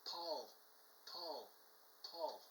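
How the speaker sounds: soft, spaced-out calls; ghostly woman calling. Retold or imitated. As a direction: imitate a woman's faint call on tape. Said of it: "you can hear a woman's voice go".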